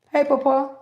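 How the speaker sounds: calling out loud to Papa; loud call to a spirit. Real, not acted. Calling out to her grandfather's spirit, a loud call.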